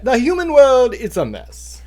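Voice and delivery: put-on Sebastian's Jamaican accent, high and loud at first. Host revealing Sebastian's quote, in a loud Jamaican accent. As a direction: belt out the crab's Jamaican accent. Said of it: "Say it in the accent and you'll know it".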